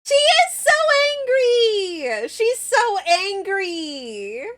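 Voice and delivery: high, loud gushing squeal with drawn-out endings; delighted squeal. Real, not acted. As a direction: squeal as if over something adorable. Delights in avatar's angry face.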